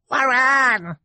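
very high, piercing little shriek; baby's shriek. Acted. Baby Sonny protests at the villain, shrieking. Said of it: "Sonny shrieked".

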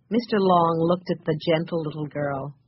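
plain reading voice

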